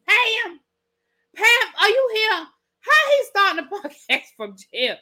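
high, loud mock distress; incredulous calling out. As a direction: call for help in hammed-up disbelief. Calling out to Pam in disbelief.

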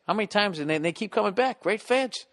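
ordinary talk

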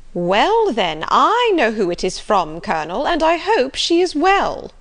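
high, loud, teasing busybody; knowing Mrs Jennings. Acted. Mrs Jennings hinting she knows who wrote, knowingly teasing. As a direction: needle him with swooping, high emphasis.

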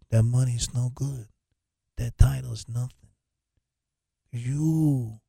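mock mob boss scolding a traitor; bad Corleone impression. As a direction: do a bad Godfather don, disowning him. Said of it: "I should do my bad, uh, Corleone impression".

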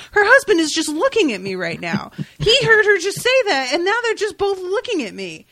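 very high, incredulous squeal of realization; high, loud, hammed-up disbelief; mock panic. Voices his mounting panic.

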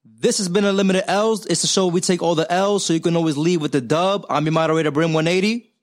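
loud, upbeat announcer sign-off; podcast host outro. Acted.

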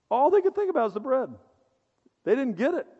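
ordinary talk

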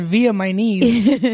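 slow, drawn-out via my knees; mock her garbled word slowly; mocking mispronunciation. Teasing cohost, slowly mimicking via my knees. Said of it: "Sound like she said".

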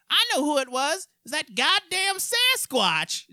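put-on high, slow croak of a little old grandma. Quoting the grandma from a comedy sketch in a high old-lady voice. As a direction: play the grandma squeaking out the punchline. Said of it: "that little grandma comes out".

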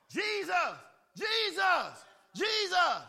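rising, high, shouted calls. Acted. Bartimaeus calling Jesus over the crowd, building to a shout. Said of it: "he just got louder and louder".

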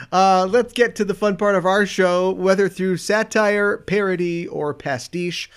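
measured, formal read-aloud intro; podcast script read. Acted. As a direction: read the segment intro like a documentary.

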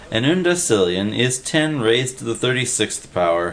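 ordinary delivery

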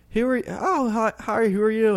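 mock chirpy questioning of a stranger; mimicking the aunt quizzing Kelsey, high and sweet; imitating the aunt. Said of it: "was like".

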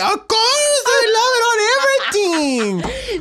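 very high, squawky loud cartoon-bird impression of the chef. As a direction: shriek it like a cartoon bird, laughing. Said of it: "her voice sounds exactly like the bird from fucking Cat Dog".

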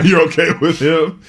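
amused disbelief, laughing; laughing question. Real, not acted. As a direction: chuckle as you ask. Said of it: "No, I'm okay".

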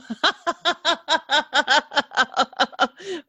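high bursting laugh. Real, not acted. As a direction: laugh out loud in surprise. Host laughs at the story.

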